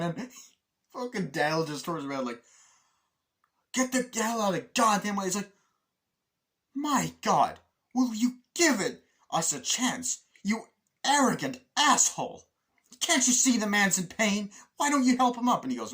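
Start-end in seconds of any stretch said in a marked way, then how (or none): 3.7-5.2 loud, high mock fury; acted exasperated shouting; angry yell. Acts angry man yelling. 6.7-15.6 loud, high acted scolding; high, emphatic dressing-down; indignant outburst. Acts indignant film character scolding.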